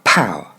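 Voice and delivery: "pow" rhyming with "cow", falling low; English first syllable. Shown as a demonstration. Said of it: "la première déjà".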